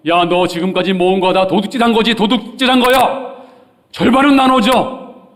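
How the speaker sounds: acted scolding, high and very loud; thundering rebuke. Preacher acting the scolding Jesus did not give Zacchaeus, thundering rebuke. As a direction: berate a thief, climbing to a loud high demand. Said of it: "호통치지 않았습니다".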